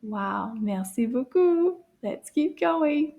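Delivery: ordinary talk, nothing marked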